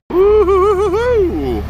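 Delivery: long, high, loud woo-hoo; wavering whoop. Real, not acted. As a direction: whoop slowly on a high, wobbling note. Whooping on finding the ugliest house.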